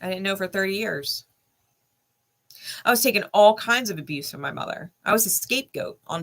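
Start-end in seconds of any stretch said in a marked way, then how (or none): none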